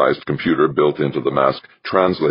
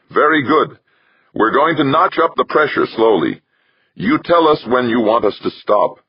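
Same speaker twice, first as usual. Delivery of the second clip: brisk, higher technician voice; 10 semitones higher, 3 dB louder than usual. Acted. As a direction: speak as the lab technician. Technician speaking in the test chamber.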